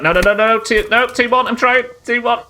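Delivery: high, loud real panic; frantic cries as the fight goes wrong; panicked shouting. Real, not acted. Streamer in a fight against three armed bandits, panicked.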